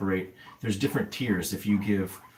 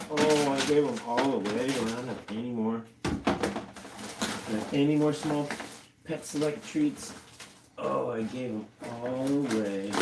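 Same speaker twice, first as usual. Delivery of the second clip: higher sing-song coo to the pets; pet talk; 5 semitones higher than usual. Real, not acted. Telling the guinea pigs the treats ran out, higher cooing.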